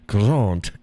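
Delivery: ordinary delivery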